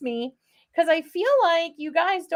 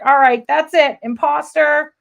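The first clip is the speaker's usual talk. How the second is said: mock accusing viewer, louder; imitating viewers; 8 dB louder than usual. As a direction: playfully call out the imposter. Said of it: "You guys are like".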